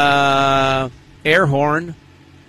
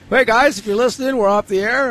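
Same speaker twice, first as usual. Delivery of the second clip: higher, sing-out pretend announcement; put-on public notice, pitched up; mock announcement; 8 semitones higher than usual. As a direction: call it out high to pretend listeners. Acts announcing it to listeners.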